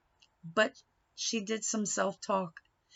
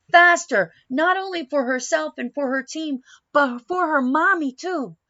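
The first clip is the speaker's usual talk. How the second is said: louder, higher, racing narration; building excitement; 4 semitones higher, 8 dB louder than usual. A narrator's reading. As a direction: push the climax, getting louder. Climax of race narration.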